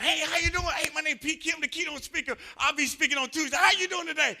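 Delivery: high, loud, over-eager networking voice; hammed-up friendly keynote speaker; imitating his eager greeting. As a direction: play his bubbly self meeting strangers. Reenacting cheerful greeting at conference.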